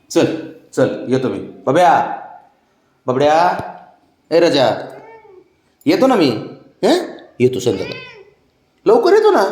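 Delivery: high, loud sing-song coaxing. Real, not acted. Man coaxing his sulking pet before leaving, high baby talk. Promising pet to return soon.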